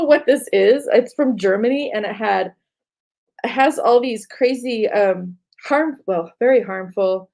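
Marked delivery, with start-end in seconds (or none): none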